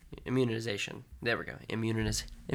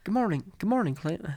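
higher-pitched attempt at a British accent; 6 semitones higher than usual. Trying out a voice for Jim, shaky attempted British accent. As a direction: have a go at British, not quite landing it. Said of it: "try to do a British accent".

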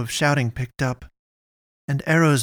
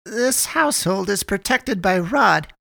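higher, slow solemn proclamation; raised, measured invocation; solemn mother; 6 semitones higher than usual. Acted. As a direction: intone it like a household prayer.

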